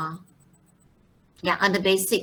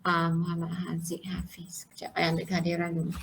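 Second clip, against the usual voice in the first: whisper; 4 semitones lower, 8 dB quieter than usual. Real, not acted. Teacher aside during attendance.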